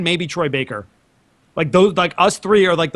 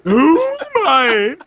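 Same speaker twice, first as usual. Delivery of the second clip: hammy wail; 8 semitones higher, 4 dB louder, much slower than usual. Acted. Dramatic outburst.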